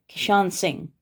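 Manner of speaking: ordinary delivery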